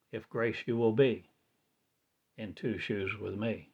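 normal talking voice

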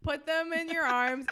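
ordinary delivery

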